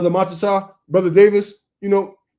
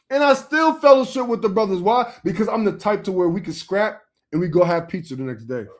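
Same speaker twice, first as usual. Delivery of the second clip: usual speaking voice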